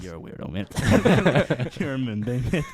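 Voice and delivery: loud, slow, laughing jab at a mate; laughing mock insult. Mocking the odd guy to his face.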